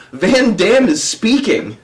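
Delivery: slowly imitating an earnest prankster; straight-faced announcement. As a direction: deliver the absurd lie with care. Prankster announces fake event.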